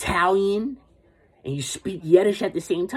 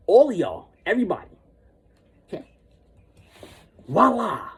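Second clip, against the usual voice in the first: normal talking voice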